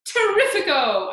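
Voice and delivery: loud, high, theatrical delight; exultant cry. A narrator's reading. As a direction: cry it out in triumph. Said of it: "Aunt Sponge cried out".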